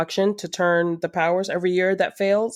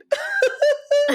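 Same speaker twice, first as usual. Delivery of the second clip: high burst of laughter; real, helpless high laugh. Real, not acted. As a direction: let out a high-pitched cackle of amusement. Cracks up after the joke.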